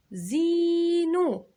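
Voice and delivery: زينو very slow, high, the ee drawn long; long vowel. Shown as a demonstration. Teacher stretching the long vowel for kids. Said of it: "الزي هنا في نص الكلمة".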